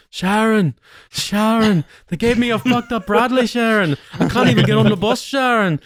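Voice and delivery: high-pitched, wailing Brummie parody; hammed-up helpless rocker cry. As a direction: wail for your wife in a mock Birmingham accent. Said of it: "interpreting a slight different version of [name]".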